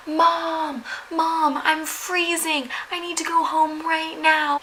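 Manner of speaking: put-on high, drawn-out whiny kid cry; mock a child wailing for mom, without real volume; high-pitched imitation of a wailing child; imitated wailing boy. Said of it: "start to hear this boy wailing".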